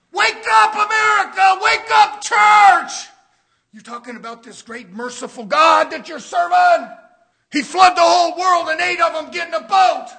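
loud, high fire-and-brimstone preaching; preaching shout. Acted. Preacher shouting to the church about Canaan. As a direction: thunder it at the congregation.

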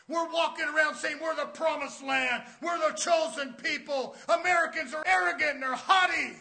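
loud, high fire-and-brimstone preaching; preaching shout. Acted. Preacher shouting to the church about Canaan. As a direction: thunder it at the congregation.

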